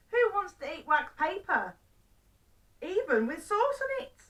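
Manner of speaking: put-on high, loud, exasperated old man; indignant questioning. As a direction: protest in rising disbelief. Old man indignant at wax paper.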